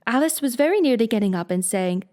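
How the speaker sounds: ordinary delivery, nothing marked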